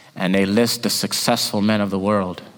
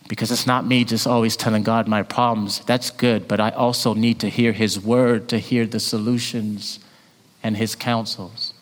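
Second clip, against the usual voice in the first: ordinary delivery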